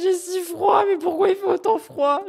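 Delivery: high, shaky cold voice; shivering. Real, not acted. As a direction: voice trembling from cold. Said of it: "je tremble".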